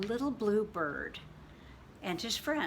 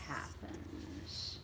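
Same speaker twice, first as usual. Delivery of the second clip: whisper; 10 semitones lower, 12 dB quieter than usual. Acted. Teasing whisper before story.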